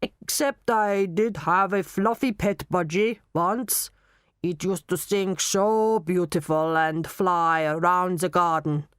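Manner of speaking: put-on slow German-accented reminiscence. Knockman remembering his pet budgie, slow German accent. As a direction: recall it slowly in a German accent. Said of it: "you will talk in a German accent".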